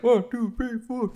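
high count-in, fading to soft by "four"; imitating a sixties band counting in; mimicking the old rock song's intro count; mock rock band count-in. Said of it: "It's just like".

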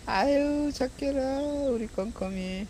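high cooing pet voice. Real, not acted. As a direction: coo up high at the kitty. Praising a cat. (고양이에게 하는 높은 애교 말투)

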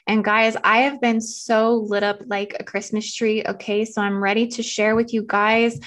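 ordinary talk

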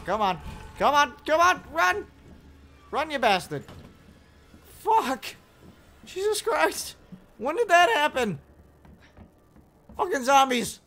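high frantic yelling; panicked shouting. Real, not acted. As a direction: yell it while running scared. Fleeing zombies.

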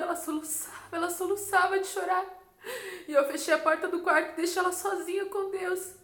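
choked up while remembering; tearful telling. Real, not acted. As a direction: recount it on the edge of tears. Still tearful telling of sobbing.

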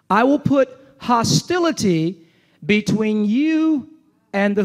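slow emphatic reading. Acted. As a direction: draw out each word for weight.

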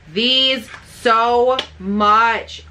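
very slow, stretched "these so much"; drawn-out gush. Real, not acted. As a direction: melt over every word. Gushing over favorite palettes.

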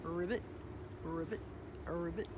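low, soft ribbits; frog croak. A narrator's reading. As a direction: croak quietly, pausing between ribbits. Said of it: "they're still there, singing".

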